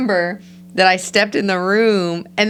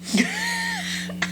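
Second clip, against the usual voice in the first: squealing burst of laughter, high and thin; high shrieking laugh; 8 dB quieter than usual. Real, not acted. Wife shrieking with laughter at her crying story.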